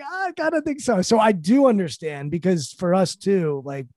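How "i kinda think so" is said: high-pitched tentative imitation; hesitant admission. Wife hinting to husband she may be pregnant, high and hesitant. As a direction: squeak it out, unsure.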